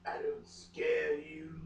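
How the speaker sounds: a hint of a low, slow vampire voice; playful low Edward. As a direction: tease her softly, low and slow. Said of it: "he asked playfully".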